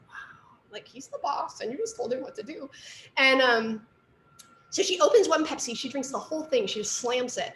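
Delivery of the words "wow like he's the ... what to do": whispering. Retold or imitated. Awed inner reaction quoted.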